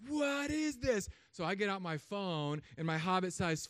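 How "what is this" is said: slow, high, amazed question. Retold or imitated. Amazed at the deer coming in, high and slow. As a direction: marvel at the deer arriving, slowly. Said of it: "I'm like".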